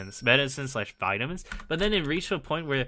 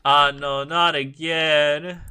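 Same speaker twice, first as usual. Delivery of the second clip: exasperated; 3 semitones higher, 7 dB louder, much slower than usual. Retold or imitated. Reacting to dog peeing more.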